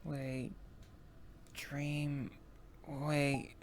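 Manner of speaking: softly, haltingly, piecing a memory together; hushed, with pauses between words; dawning recollection. Acted. Suddenly recalling a dream.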